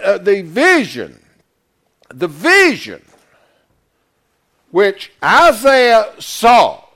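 loud, emphatic sermon delivery; full-force preaching. Real, not acted. Preacher expounding Isaiah's vision to the congregation, loud and emphatic. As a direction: drive the scripture home, loud and punched.

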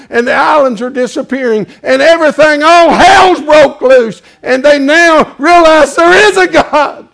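loud and high, slowing on the last words; thundering doomsday sermon; apocalyptic preaching. Acted. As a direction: paint the end of the world.

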